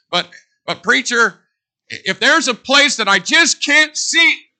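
high, loud and slow, full force; preaching shout. Real, not acted. Preacher pleading with the congregation, high loud shout. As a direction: hammer it home from the pulpit.

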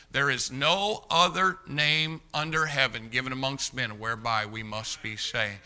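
normal talking voice

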